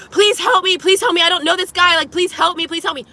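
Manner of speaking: high, loud desperate plea; retold screaming for help. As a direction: beg strangers for help. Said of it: "I immediately start screaming".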